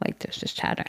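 hushed, voiceless repeat of the line; whisper. Shown as a demonstration. Mom whispering to show the murmuring stands. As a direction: drop to a whisper to mimic the crowd chatter.

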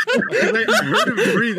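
high, loud laughing; words squeezed out through laughter; laughing hard at the prank. Real, not acted. Laughing while explaining the prank.

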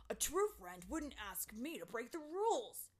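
Terrance yelling back. A narrator's reading. Terrance answers Tink's accusation, angry yelling. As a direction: yell back in a put-on voice. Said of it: "Terrance yelled back".

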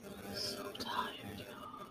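breathless tired whisper. Real, not acted. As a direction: whisper it, worn out. Aside to viewers, tired.

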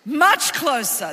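Much closer, slow and loud with weight on much; hammered-home phrase; slow emphatic. Shown as a demonstration. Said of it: "slow emphatic repeat".

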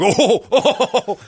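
high, loud laughing oh's; hammed-up belly laugh; imitated laughter. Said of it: "out loud laughter".

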